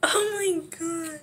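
drawn-out high groan of pain; real pain, high and slow. Real, not acted. The mask stings near her eye.

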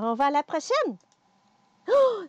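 ordinary talk

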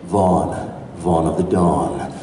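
put-on low, slow heroic boy voice; Vaughn character voice. Shown as a demonstration. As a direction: drop low and drawl it out. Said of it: "my character sounds like this".

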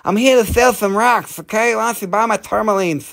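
high voice with a heavy lisp; Mike Tyson. Acted. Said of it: "Do a Mike Tyson".

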